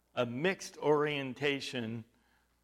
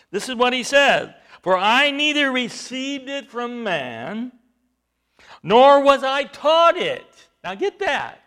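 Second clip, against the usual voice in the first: slow, much louder, higher preacher's shout; preaching climax; 6 semitones higher, 12 dB louder than usual. Acted. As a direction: thunder it out to the congregation. Climax of the sermon.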